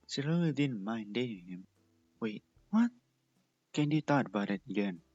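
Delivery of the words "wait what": surprised. Acted. Sudden realization.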